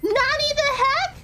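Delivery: high shocked disbelief. Real, not acted. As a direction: gasp out the questions in shock. After jump scare, shocked.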